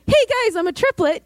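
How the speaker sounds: high-pitched, chirpy pretend announcement; mock announcement. Speaker pretends to drop triplet news into conversation, mock announcing. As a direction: announce it brightly, as a joke.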